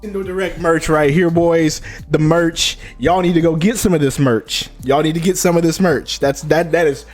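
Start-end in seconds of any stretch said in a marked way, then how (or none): none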